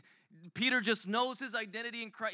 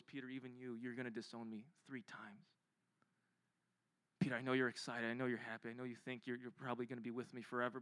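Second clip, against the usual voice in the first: gentle sad warning; 7 semitones lower, 10 dB quieter than usual. Retold or imitated. Jesus quietly telling eager Peter he will fall away. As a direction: lower and much softer, sorrowful.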